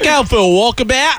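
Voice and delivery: hammed-up Aussie voice; Australian accent. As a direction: toss out Aussie slang in accent. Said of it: "That's Australian".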